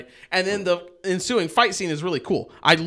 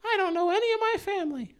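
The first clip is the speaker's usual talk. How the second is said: put-on much higher, childlike pout; high little-girl voice; 4 dB quieter than usual. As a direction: voice her as a young daughter. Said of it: "his daughter had been like".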